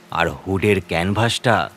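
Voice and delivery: usual speaking voice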